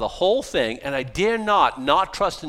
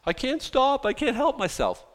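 mock helplessness; 7 semitones higher than usual. Mocking a drinker's excuse.

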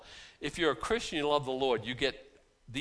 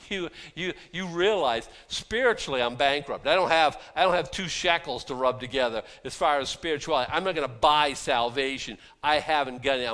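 louder, driving preacher voice; fervent preaching; 2 semitones higher, 7 dB louder than usual. Acted. Preacher pressing the congregation on spiritual bankruptcy, fervent. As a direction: pound it home from the pulpit.

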